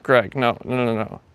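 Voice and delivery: ordinary talk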